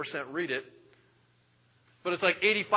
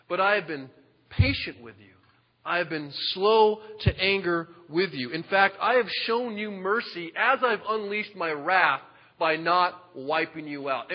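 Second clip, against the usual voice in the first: slow and louder, each word placed; deliberate divine warning, drawn out; God calmly telling Pharaoh he spared him; slow, weighty God voice; 2 semitones higher, 4 dB louder than usual. Acted. God speaking to Pharaoh, building.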